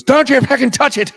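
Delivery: high, loud mock Irish bartender yell; furious shout. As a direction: yell at the customer not to touch it. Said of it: "he goes".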